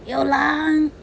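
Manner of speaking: high, loud cry of alarm; shouting wolf. Acted. Mother teaching Jack, acting out his loud wolf cry. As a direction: call out to the village that a wolf is here. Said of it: "你要大叫".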